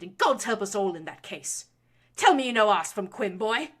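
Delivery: loud, high, rising fury; lashing out. A narrator's reading. Baroness lashing her son for lecturing her. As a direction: build the tirade to a shrieking peak.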